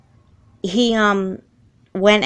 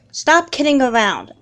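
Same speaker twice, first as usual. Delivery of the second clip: ordinary delivery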